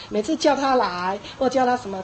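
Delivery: normal talking voice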